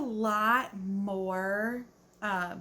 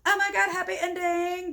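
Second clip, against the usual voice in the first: mock gushing; 10 semitones higher, 6 dB louder than usual. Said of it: "it's not like a".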